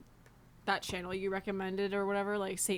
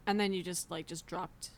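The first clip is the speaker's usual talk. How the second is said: normal talking voice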